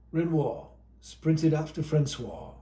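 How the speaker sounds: ordinary delivery, nothing marked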